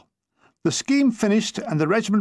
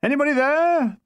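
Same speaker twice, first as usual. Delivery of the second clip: shouting into the dark; 9 semitones higher than usual. Acted. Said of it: "I shouted into the darkness".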